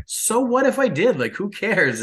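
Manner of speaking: high-pitched, mock-defiant comeback; mock dismissive Jerry. Imitating Jerry answering Donna's complaint, high and dismissive. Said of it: "And he's just like".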